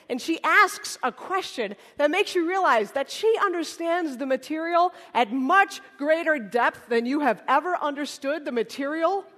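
usual speaking voice

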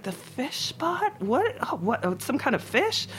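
high, slow, baffled echo of the order. Retold or imitated. Young cook baffled by the chef's Kiwi order, high and slow. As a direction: puzzle over a word you don't know.

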